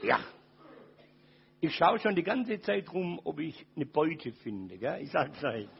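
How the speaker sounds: usual speaking voice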